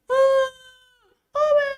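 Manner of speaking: put-on high, drawn-out child's wail; mock crying wail. A child who fell wails to mother, mock crying. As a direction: imitate a child wailing for Mum. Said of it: "Umi nangis".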